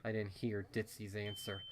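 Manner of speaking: usual speaking voice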